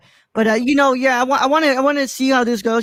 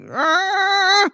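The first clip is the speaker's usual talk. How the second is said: mock growl; 11 semitones higher than usual. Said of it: "And Brad's like".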